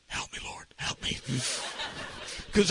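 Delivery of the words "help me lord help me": hushed plea to God; whispered prayer. Retold or imitated. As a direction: pray urgently under the breath.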